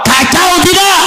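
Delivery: high, shouted to the crowd; preacher shouting the question back. Acted. Preacher asks the crowd twice.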